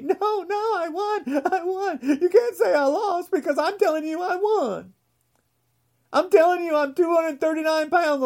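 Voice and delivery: high, whining crybaby voice, laughing through it; whiny Trump. Retold or imitated. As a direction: play Trump as a whining toddler insisting he won. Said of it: "But not Donnie".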